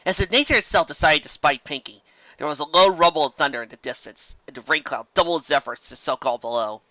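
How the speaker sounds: ordinary delivery, nothing marked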